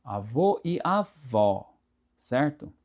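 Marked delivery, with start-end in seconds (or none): 0.0-1.7 slow "avô" closed O, "avó" open Ó; avô versus avó. Shown as a demonstration. Teacher contrasts grandfather and grandmother, slow. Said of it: "acento agudo".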